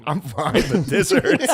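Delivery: rising to a high, laughing wince; wincing mom. Retold or imitated. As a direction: mom downplaying pain. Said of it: "And she was just like".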